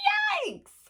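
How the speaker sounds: very high squeal of alarm; shrieky storybook surprise; startled yelp. A narrator's reading. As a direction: squeak out a comic shock.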